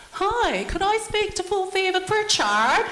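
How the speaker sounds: very high, loud put-on Palangi accent; Palangi phone voice. Daughter-in-law disguising herself to prank her mother-in-law, Palangi phone voice. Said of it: "put on my phone voice, change my, put on my Palangi voice".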